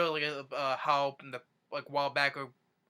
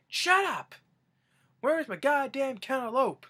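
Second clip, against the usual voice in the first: angry shouting; 11 semitones higher, 6 dB louder than usual. Retold or imitated. Imitating Grandpa yelling on the phone.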